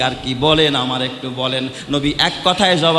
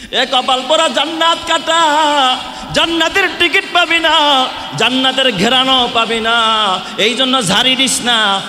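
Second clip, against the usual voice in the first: high, loud rebuke; furious pulpit shout; sermon shout; 10 semitones higher, 5 dB louder than usual. Acted. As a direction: shout the curse at the crowd. Preacher shouting at undutiful children.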